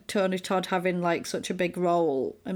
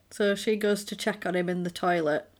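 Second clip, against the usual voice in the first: ordinary delivery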